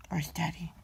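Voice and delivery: soft unvoiced whisper; whispered question to the pet. Real, not acted.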